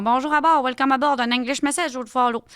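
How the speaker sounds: loud, high Saguenay flight attendant. Retold or imitated. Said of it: "j'ai fait une hôtesse de l'air".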